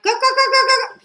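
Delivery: high mock kookaburra cackle; kookaburra call. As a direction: imitate the kookaburras laughing, high and loud. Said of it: "they were like".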